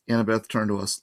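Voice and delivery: ordinary delivery, nothing marked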